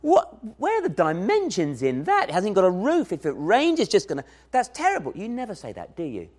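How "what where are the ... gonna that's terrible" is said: high, scornful, mocking a child's drawing; mocking laugh. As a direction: scoff at the child's picture. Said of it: "Do you laugh and say".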